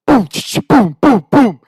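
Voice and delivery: loud, high booms with rifle-cycling noises; imitated gunfire. Drunk hunter unloads his rifle, explosive. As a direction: fire off explosive mock gunshots.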